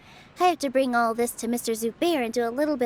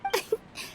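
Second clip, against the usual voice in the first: a short embarrassed chuckle; sheepish little laugh; 8 semitones higher, 7 dB quieter than usual. Acted. Host laughs sheepishly at the teasing, embarrassed chuckle. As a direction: laugh it off, a bit guilty.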